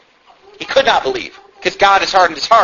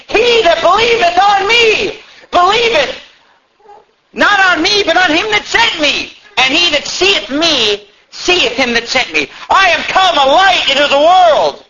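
high, shouted scripture quote; crying out; 11 semitones higher, 3 dB louder, slower than usual. Acted. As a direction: cry it out like a preacher, pitched high and drawn out. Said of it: "Jesus cried and said".

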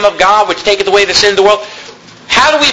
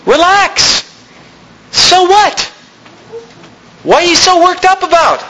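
higher, slow, breezy "chill out" tone; casual paraphrase of God; 10 semitones higher, slower than usual. Retold or imitated. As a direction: brush off the worry lightly. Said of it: "He says".

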